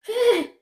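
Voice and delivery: high, soft "hé" of dismay; dismayed cry. Retold or imitated. Storyteller realising she is trapped by her lie, dismayed cry. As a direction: let out a high yelp of alarm.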